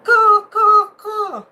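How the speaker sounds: high, loud cawing crow call; loud imitated crow caws. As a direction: caw like a crow calling out. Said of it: "and he goes".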